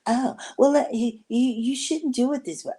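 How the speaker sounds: high mock bossy friend voice; high, pushy know-it-all tone; mimicking the friend. Imitating Martha telling her how to cook.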